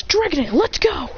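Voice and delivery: high rallying call. Acted. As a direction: urge your partner on in a high voice.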